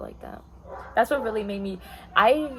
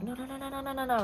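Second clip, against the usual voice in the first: higher, soft string of rapid no's; imitated hasty objection; 4 semitones higher, 3 dB quieter than usual. Manager stopping an argument, imitated rapid soft objection. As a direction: imitate a manager cutting in, light and high. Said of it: "my manager was like".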